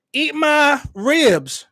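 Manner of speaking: high, slow hammed-up pitch voice; mock restaurant ad. Mocking restaurant owners plugging their own ribs in a hammed-up voice. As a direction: ham up a cheesy owner plug. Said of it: "Most restaurants have their own social media game".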